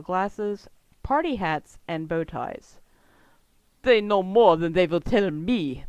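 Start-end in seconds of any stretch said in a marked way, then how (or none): 3.8-5.8 grumbling villain complaint; grumpy. Acted. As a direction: say it sourly, fed up. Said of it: "the Magentalist says grumpily".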